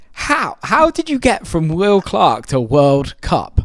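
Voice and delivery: loud, high, drawn-out incredulity; slow, exasperated demand, played up for the story; retold disbelief. Artist retelling reply to misheard name, incredulous. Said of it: "I'm like".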